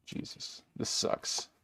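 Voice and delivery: a defeated hushed curse to yourself; whispered frustration. Real, not acted. Sorting game shelf, cursing to himself in a whisper. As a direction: whisper it under your breath, fed up.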